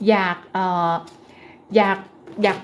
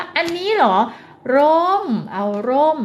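ร่ม stretched very slowly and higher; stress on ร่ม; 4 semitones higher, slower than usual. Shown as a demonstration. Therapist names the umbrella for a toddler, stressing ร่ม. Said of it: "การเน้นคำเนาะ".

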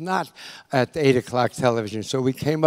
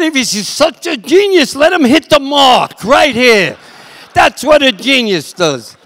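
loud, high grumbling retort; hammed-up indignant yell; indignant cameraman; 9 semitones higher, 8 dB louder than usual. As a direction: act out a crew veteran shouting back. Cameraman retorts in retold argument.

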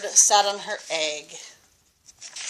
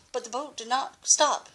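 usual speaking voice

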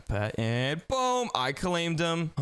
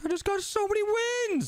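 excited squeal. Real, not acted. Victory over sensei.

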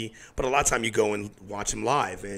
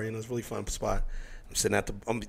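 usual speaking voice

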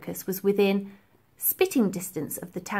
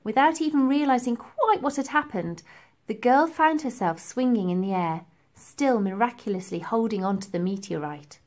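ordinary delivery

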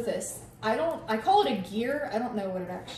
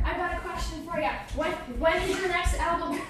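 reporter voice; 6 semitones higher than usual. Pretend. Playing reporter questioning the star.

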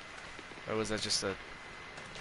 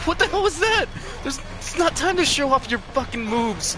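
high, loud incredulous yell; shocked, half-laughing outrage; exasperated disbelief; 12 semitones higher, 4 dB louder than usual. Real, not acted. Teammate misbehaving.

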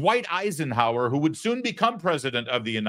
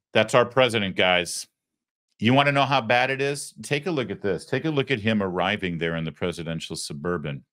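ordinary talk, nothing marked

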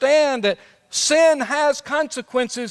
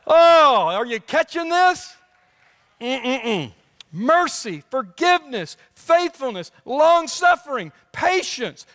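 fervent preaching; 5 semitones higher, slower than usual. Acted. Excited list of God's attributes.

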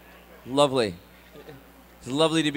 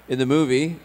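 normal talking voice

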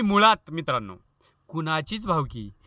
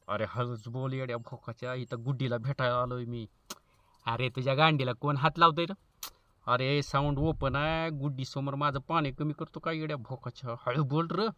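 much softer, lower hushed pleading; hushed phone reply; 5 semitones lower, 8 dB quieter than usual. Acted. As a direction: hush him so the girl won't hear. Friend hushing on phone.

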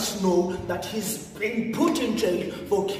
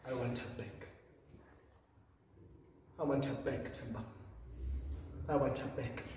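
very soft, low pleading; quiet grief; 8 semitones lower, 16 dB quieter than usual. Acted. Begging Themba for the wife back, hushed and grieving. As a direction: sink almost to nothing, repeating the plea. Grieving plea for wife's return.

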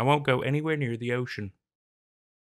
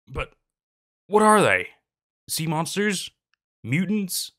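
pitched well up and louder in disbelief; incredulous questions; 7 semitones higher, 5 dB louder, slower than usual. Acted. Sailor begs the captain for answers, incredulous and loud. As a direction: fire off baffled guesses, voice climbing. Narrator's confused rising questions.

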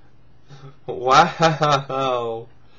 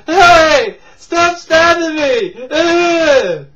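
high, loud laughing protest ending in a groan; mock yell; 13 semitones higher, 10 dB louder, much slower than usual. Acting out the stabbed guy again, hammed-up loud scream. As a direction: scream it hammed up, slow, with a grunt. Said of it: "It's like".

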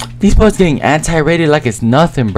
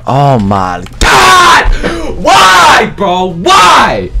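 drawn-out, high exasperated yell; exasperated shout; 7 semitones higher, much slower than usual. Real, not acted. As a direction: cry out in protest, stretching the words. Exasperated outburst at a mishap in game.

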